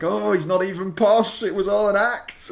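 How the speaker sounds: a hint of a Cockney voice; generic Cockney. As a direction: slip into a Cockney accent. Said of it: "talking like this, generic Cockney".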